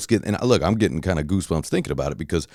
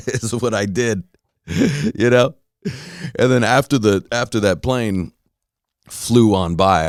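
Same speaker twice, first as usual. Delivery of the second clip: ordinary delivery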